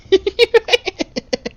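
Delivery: very high-pitched giggle. Real, not acted. Gamer to self while heading back, high giggle.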